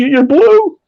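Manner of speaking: high, alarmed wife voice; wife startled at his blue lips, high and alarmed. Retold or imitated. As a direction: pitch way up as the shocked wife.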